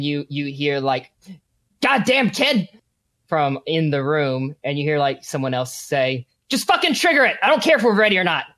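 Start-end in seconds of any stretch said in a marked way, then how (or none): 1.8-2.7 higher, exasperated outburst; angry cursing. Acted. Game master as a man cursing the kid, angry outburst. Villain cursing the escaped boy. 6.5-8.4 high, rushed, exasperated command; angry order. Acted. Game master as someone ordering the trigger, angry and rushed. Acted villain giving an urgent order.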